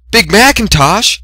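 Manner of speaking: high, slow, loud call after him. A narrator's reading. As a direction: call his name as he runs away. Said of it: "Fluttershy called after him".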